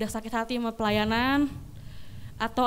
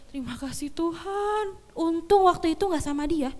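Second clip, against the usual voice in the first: grateful exclamation; 5 semitones higher than usual. Retold or imitated. Grateful outburst quoted to herself.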